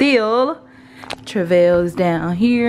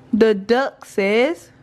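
slow, drawn-out rising prompt; sing-song prompt; 3 semitones higher, much slower than usual. Real, not acted. Prompting baby.

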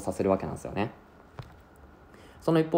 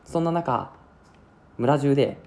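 usual speaking voice

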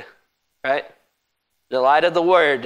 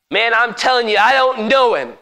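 raised, higher-pitched protest; emphatic denial; 8 semitones higher, 5 dB louder than usual. Acted. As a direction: deny it forcefully, voice rising. Acted denial by Peter.

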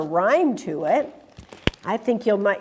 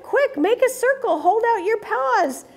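mock yell; 10 semitones higher than usual. Pip organizes the rescue.

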